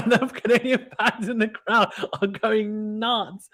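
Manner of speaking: laughing high through the words; delighted, chuckling as you speak. Real, not acted. Commentator amused by cheering Canadian fans, laughing.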